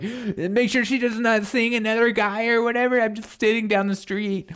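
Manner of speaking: high-pitched mock stalker voice; creepy ex.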